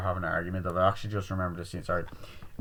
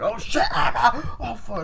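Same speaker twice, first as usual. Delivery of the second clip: high, loud, drawn-out mock scream; panicked screaming; 13 semitones higher, 5 dB louder than usual. Retelling friends finding Emma killed, mock panicked screaming.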